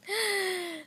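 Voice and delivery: sharp, high gasp of delight. Real, not acted. Opening a handmade charm, a sharp delighted gasp. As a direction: gasp as if the gift just stole your heart.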